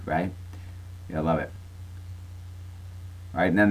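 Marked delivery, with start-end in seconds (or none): none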